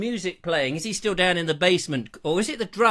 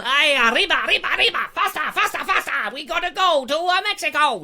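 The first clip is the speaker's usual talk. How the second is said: high, hurried cartoon Mexican accent; mock Mexican; 8 semitones higher, 4 dB louder, faster than usual. Travellers rushing to Mexico, high mock Mexican accent. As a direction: urge them on in hammed-up Mexican.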